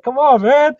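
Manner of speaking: high, loud mock pleading; exasperated retold plea. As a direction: plead in exaggerated disbelief, pitch jumping up. Retelling his plea to director.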